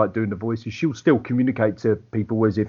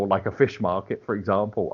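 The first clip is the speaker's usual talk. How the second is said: ordinary talk, nothing marked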